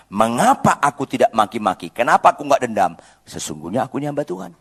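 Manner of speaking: ordinary talk, nothing marked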